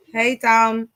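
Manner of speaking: ordinary talk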